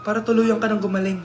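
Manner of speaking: solemn doctor. Acted. Doctor explains mother's illness.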